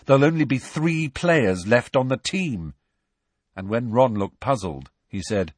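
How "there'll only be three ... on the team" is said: higher, irritated retort; raised, exasperated voice; testy. A narrator's reading. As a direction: point it out impatiently. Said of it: "said Harry testily".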